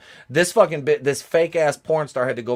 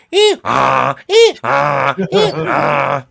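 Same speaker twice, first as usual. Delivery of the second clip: donkey bray; 6 dB louder, much slower than usual. Retold or imitated.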